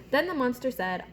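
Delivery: normal talking voice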